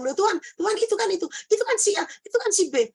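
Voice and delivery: high-pitched, quick astonished exclaiming; re-enacting amazement, voice shooting up; startled recognition. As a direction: point people out in excited disbelief. Acted startled recognition during vision.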